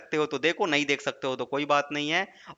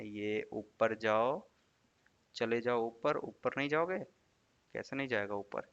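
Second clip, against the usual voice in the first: low, very soft mumble; muttering to oneself; 6 semitones lower, 10 dB quieter than usual. Real, not acted. Teacher coaxing a stuck page upward, muttering. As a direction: trail down into a quiet private mutter.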